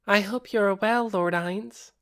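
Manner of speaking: put-on a coy vampire girl curtsying to her master; girlish vampire voice. A narrator's reading. Shaltir voiced in story.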